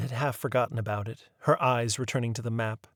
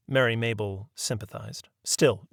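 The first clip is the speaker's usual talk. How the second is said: plain reading voice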